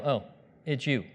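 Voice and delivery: mock nonchalance; unimpressed. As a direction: shrug it off with a falling tone. Acts out indifference to the lion.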